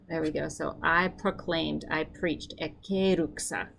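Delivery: normal talking voice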